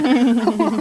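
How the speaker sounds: high bright laugh; burst of laughter. Real, not acted. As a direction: laugh out loud, amused. Host laughs at co-host's remark.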